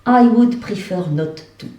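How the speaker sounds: put-on English in a French provincial accent; homegrown English accent. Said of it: "Ça, c'est l'accent anglais". (anglais avec l'accent français de Saint-Flour)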